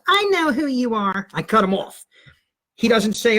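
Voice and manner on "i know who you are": high, slow cutting interruption; fake mom voice. Teen as his mother cuts the teacher off, high and slow. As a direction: cut the caller off as Mom. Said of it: "I got the voice down pat".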